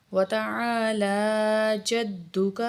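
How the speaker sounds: جَدُّكَ with the doubled dal pressed hard; slow, careful tajweed; firm doubled dal. Shown as a demonstration. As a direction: stretch تَعَالَى and stress the dal in جَدُّكَ. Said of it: "دال کے اوپر شد ہے تو تھوڑا سختی سے پڑھیں گے".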